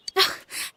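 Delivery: soft breathy giving-up sigh; resigned sigh. Real, not acted. Teased by her date, a small resigned sigh. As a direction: let the air out, conceding.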